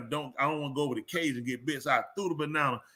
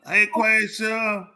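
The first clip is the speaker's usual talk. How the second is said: a long, slow, louder hey Quaisha; drawn-out greeting; 6 semitones higher, 5 dB louder, much slower than usual. Real, not acted. Calling a friend over with a slow stretched hey.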